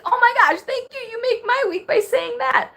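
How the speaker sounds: very high, squealing thanks; genuinely touched and thrilled; overjoyed squeal. Real, not acted. Viewer's kind comment.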